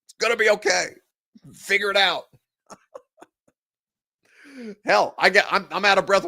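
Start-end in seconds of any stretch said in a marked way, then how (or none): 0.0-2.2 higher, pleading mock-soothing "it's gonna be okay"; mock reassurance. Host mock-calming fans about Inhaler Gate, higher and pleading. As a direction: shake the panicking fans and soothe them, half-joking. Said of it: "digitally shake you and say".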